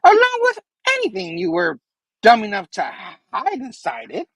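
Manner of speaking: high, theatrical villain with swooping pitch; Goblin. Acted. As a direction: taunt in a squeaky comic-villain voice. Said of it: "He's like".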